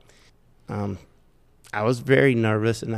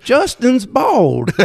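slow indignant protest; 11 semitones higher, 6 dB louder, much slower than usual. Retold or imitated. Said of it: "And I said".

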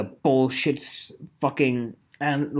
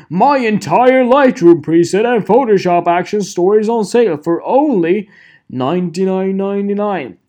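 loud, higher mock sales pitch; all-caps ad read; 5 semitones higher, 9 dB louder than usual. Mocking a preset sale ad for viewers, loud and high. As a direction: blast it like a caps-lock ad. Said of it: "guess if the next text is in caps".

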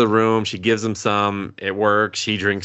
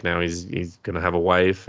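ordinary delivery

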